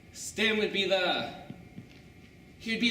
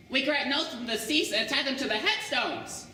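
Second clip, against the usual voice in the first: Stan's voice; 6 semitones higher than usual. Acted. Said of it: "He says".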